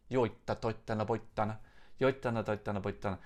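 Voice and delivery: gibberish in a playful fake Finnish accent; sing-song nonsense Finnish, mostly own voice; jokey fake Finnish accent; mock Finnish accent. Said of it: "That's my, um, totally inappropriate Finnish accent".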